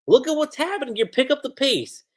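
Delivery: high-pitched, hurried mock prodding; urging. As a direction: act out an impatient teammate pushing him along. Retold teammate urging him to hurry.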